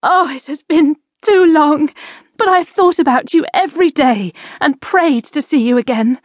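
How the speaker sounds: on the edge of tears, overjoyed; mother greeting her children, close to tears; fighting tears. A narrator's reading.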